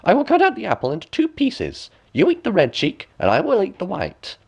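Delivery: high sly crone; high coaxing crone; old woman. A narrator's reading. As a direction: tempt her like a scheming hag. Old woman offering apple.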